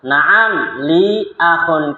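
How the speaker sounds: slow, high, careful Arabic dialogue reading; slow lesson reading. Shown as a demonstration. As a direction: read each line slowly for students to repeat. Dialogue drill.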